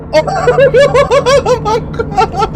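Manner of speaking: high shrieking laugh of terror; terrified shriek. Real, not acted. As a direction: shriek it out in panic. Screaming in horror game.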